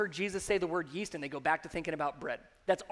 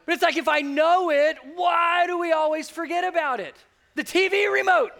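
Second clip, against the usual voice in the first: much higher, shouting preacher; preaching shout; 7 semitones higher, 8 dB louder than usual. Real, not acted. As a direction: build into a full pulpit shout. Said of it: "I'm gonna just preach for a second".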